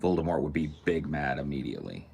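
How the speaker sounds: usual speaking voice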